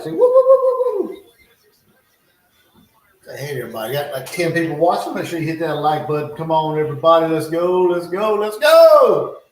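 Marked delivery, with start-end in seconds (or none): 0.1-1.2 high falsetto woo-woo-woo; loud, very high hooting; playful dog howl. Pretend. Coaxing dog to howl for viewers. 6.4-9.4 real rousing cheer, peaking on the last "go"; rallying shout. Real, not acted. Rallying viewers to hit like.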